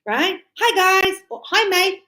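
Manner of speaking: high, loud hey guys; party greeting. Shown as a demonstration. DJ greets partygoers, high and loud. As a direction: greet the party crowd with energy. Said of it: "No, you".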